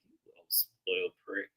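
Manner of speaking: a low-level muttered put-down; muttered insult. Retold or imitated. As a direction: mutter it to yourself at the screen. Retold silent reaction watching Charlie.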